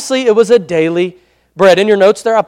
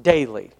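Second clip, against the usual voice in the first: "daily" set apart by a pause, pitched low; emphasis on DAILY; 8 semitones lower, 6 dB quieter than usual. Real, not acted. Preacher underlining his key word, "daily" low and separate. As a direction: drop "daily" in on its own, deliberately. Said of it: "Emphasis on the word".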